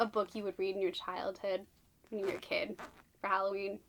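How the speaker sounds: ordinary talk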